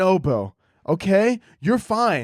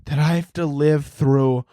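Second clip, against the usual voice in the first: mock crying girl; slower than usual. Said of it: "she was like".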